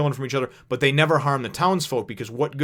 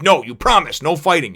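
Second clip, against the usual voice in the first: scolding Nami; 5 semitones higher, 8 dB louder than usual. Retold or imitated. Nami voiced scolding Luffy.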